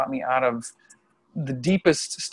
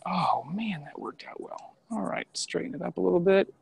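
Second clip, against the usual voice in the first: softly whispered aside; 4 semitones higher, 9 dB quieter than usual. Real, not acted. Vlogger fiddling with the camera, whispered aside. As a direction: breathe it under your breath.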